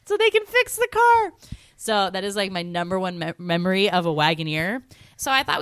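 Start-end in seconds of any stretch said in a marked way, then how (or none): 0.1-1.3 excited. Real, not acted. Retelling the chase, still excited.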